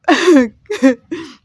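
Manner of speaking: loud embarrassed burst of laughter; real loud laugh. Real, not acted. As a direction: let out a big laugh. Still laughing at herself.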